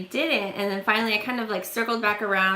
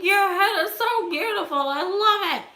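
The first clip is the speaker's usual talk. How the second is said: much higher mock gushing; gushing stranger; 10 semitones higher than usual. Stranger praising her shaved head, hammed-up gushing. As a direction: overdo the stranger's adoration. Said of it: "He's like".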